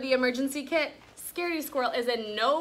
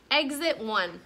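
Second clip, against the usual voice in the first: usual speaking voice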